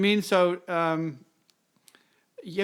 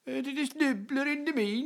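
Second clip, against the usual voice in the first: mock Scandinavian accent; 8 semitones higher than usual. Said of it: "that's not even English".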